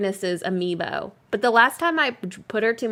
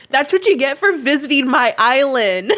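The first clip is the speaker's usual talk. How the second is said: louder, laughing through the words; laughing tease; 5 semitones higher, 4 dB louder than usual. Real, not acted. To Lex for visiting her island, laughing tease. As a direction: playfully rub it in while laughing.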